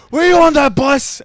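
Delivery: high, loud shouted challenge; imitated yelling. Border guard questions traveler about the bus, yelling. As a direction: yell it as the suspicious guard. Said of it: "this guy keeps on yelling".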